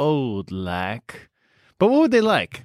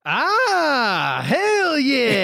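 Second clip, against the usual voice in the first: cheer; 11 semitones higher, much slower than usual. Acted. Player cheering at bingo.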